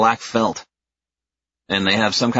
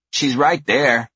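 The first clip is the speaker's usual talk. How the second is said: put-on little girl voice; 6 semitones higher, slower than usual. A narrator's reading. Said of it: "the older one says".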